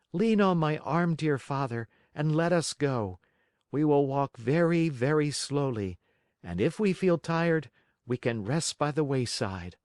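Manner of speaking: put-on high, gentle boy voice; caring puppet son. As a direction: coax a tired father along. Pinocchio helps weak father.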